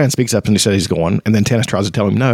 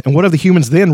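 a touch harsh, pitched up and louder; 6 semitones higher, 3 dB louder, faster than usual. Acted. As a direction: challenge the group, a mild harsh edge. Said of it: "Riverwind asked harshly".

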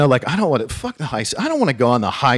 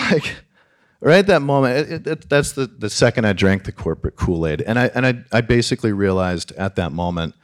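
usual speaking voice

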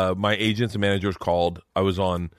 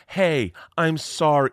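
high, drawn-out mock-sweet sorry; patronizing apology; 7 semitones higher, much slower than usual. Husband plays wife retrying her apology, patronizing and high. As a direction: imitate a wife overdoing her apology condescendingly. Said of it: "Now you're patronizing me".

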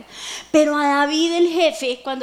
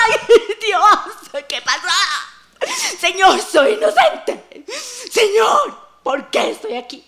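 high, loud, laughing wail; hammed-up desperate plea to God; David lamenting; 7 semitones higher, 6 dB louder, slower than usual. Preacher imagines David in the cave, hammed-up wail. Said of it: "yo me imagino que David dijo".

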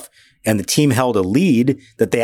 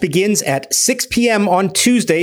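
ad read; 7 semitones higher, slower than usual. Acted. Sponsor ad read, slow.

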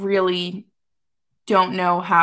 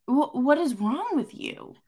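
slow, higher-pitched mock confrontation; exasperated disbelief; 5 semitones higher than usual. Player asking the coach what is wrong, exasperated. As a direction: act out an indignant player challenging the coach.

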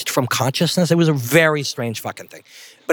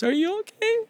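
very high, quieter tearful squeak; bawling wife; 4 dB quieter than usual. Retold or imitated. Crying wife asking after her injured husband, bawling. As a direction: imitate a sobbing wife, pitched way up. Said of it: "And she's bawling".

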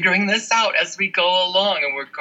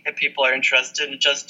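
normal talking voice

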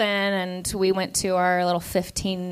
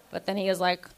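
usual speaking voice

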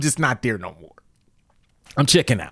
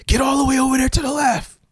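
mock shouted order; 11 semitones higher than usual. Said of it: "He's like".

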